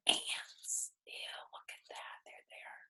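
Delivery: put-on whispered "ew" to the listening child; breathy whispered ew; hushed disgust. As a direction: whisper it like sharing a gross secret. Spooky aside to child.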